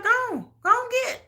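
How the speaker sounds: high, sassy go and get; high mock taunt. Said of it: "Be like".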